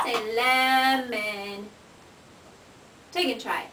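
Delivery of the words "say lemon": slow modelling. Shown as a demonstration. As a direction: say lemon very slowly, stretching the word. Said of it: "Can you try that?".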